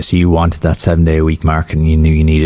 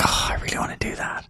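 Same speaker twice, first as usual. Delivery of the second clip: whispered; 14 dB quieter than usual. Retold or imitated. Mimicking eager listeners.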